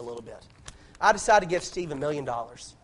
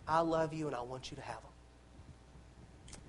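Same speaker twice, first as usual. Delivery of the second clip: put-on soft, slow, hushed reassurance to a child; a loving parent softly insisting the gift is theirs; tender parent; 2 semitones lower, 15 dB quieter, slower than usual. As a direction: gently tell the child you love them, almost under your breath. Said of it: "The parent's gonna say".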